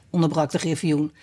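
usual speaking voice

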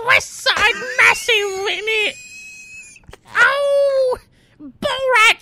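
very high, loud exaggerated Cockney accent; mock Cockney shouting. Doing the actor's strong Cockney accent for his co-host, high shouting Cockney. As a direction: squeal a cartoon Cockney at full volume. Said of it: "extremely strong Cockney accent".

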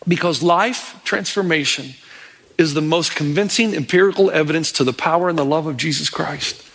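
normal talking voice

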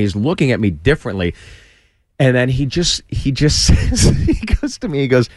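normal talking voice

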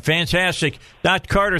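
ordinary delivery

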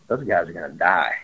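low, flat warning in a put-on voice; grim guide quote. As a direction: lightly voice the guide's grim verdict. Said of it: "and he goes".

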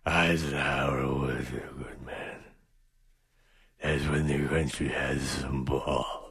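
put-on low, soft old-timer mumble; doddering old man. Host parodies the old pianist reminiscing, low old-man mumble. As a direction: reminisce quietly, deep down in pitch.

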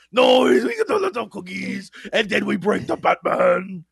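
excited monster shout; hammed-up creature insisting on treats; Cookie Monster. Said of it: "Bane doing the Cookie Monster".

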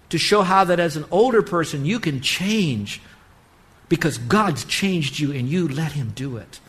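put-on a pastor rallying young and old to change; preaching at full force. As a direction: lift them up with hope. Rising exhortation to the congregation.

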